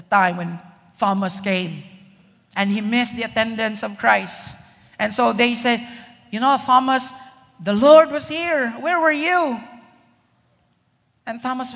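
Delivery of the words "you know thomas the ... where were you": raised, louder, eager telling of news; excited disciples. Retold or imitated. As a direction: burst out with the news to a friend who missed it.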